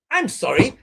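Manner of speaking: ordinary talk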